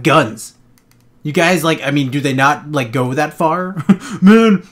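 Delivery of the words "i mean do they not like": ordinary delivery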